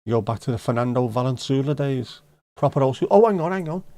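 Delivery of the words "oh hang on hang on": higher, louder sudden alert; excited. Real, not acted. Spotting something on screen, excited interruption. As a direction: jump in urgently.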